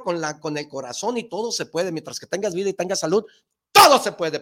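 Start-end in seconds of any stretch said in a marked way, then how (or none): 3.7-4.4 loud emphatic shout. Real, not acted. Motivating cousins, shouting that everything is possible. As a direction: belt it out with conviction. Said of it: "estás gritando".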